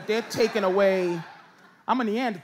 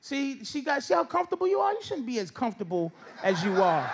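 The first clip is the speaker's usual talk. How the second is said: usual speaking voice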